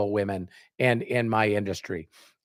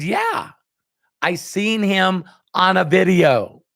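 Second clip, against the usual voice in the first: high, louder eager yeah; eager reply; 7 semitones higher, 4 dB louder, slower than usual. Retold or imitated. Young recruit to mentor, eager and high. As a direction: jump in, thrilled to know him.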